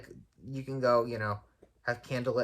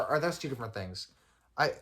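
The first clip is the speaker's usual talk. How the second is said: ordinary talk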